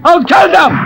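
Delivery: furious yell at the cheater; raging threat shouted across the market; angry shout. Acted.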